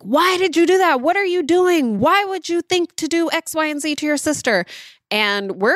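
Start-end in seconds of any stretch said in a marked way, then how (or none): 0.0-4.6 half yelling, half lecturing. Retold or imitated. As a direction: play the exasperated parent grilling the kid. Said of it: "It's usually we're yelling like".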